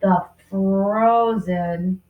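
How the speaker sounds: very slow, stretched; teacher writing slowly for pupils; going slow. Shown as a demonstration. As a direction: drag out the words as you write. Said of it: "I'm going slow".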